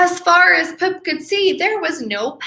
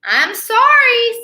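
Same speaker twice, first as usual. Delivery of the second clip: sorry whale; 9 semitones higher, 5 dB louder than usual. Acted. Whale apologises.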